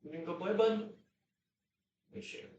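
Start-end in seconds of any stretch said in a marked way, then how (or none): 0.0-0.9 high pet voice. Real, not acted. Asking dog Ted to play, high soft baby talk. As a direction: coo the question up high.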